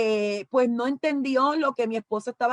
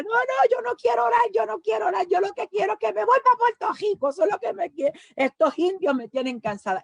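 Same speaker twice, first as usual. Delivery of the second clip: retold refusal; 9 semitones higher than usual. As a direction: yell it like a tearful woman who won't pray. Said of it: "Y yo decía".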